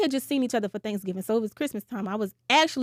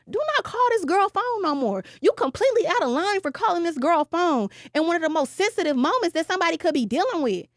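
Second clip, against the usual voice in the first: much higher, stern mock-mother voice; mama scolding; 6 semitones higher than usual. His mama telling the caller off over the phone, high and stern. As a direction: raise the pitch and lay down the law like an outraged mother.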